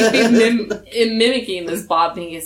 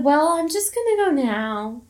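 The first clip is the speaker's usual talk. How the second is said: high mock voice; 8 semitones higher than usual. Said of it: "I said".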